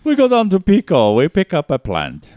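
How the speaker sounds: put-on Persian accent. Mock accent joke.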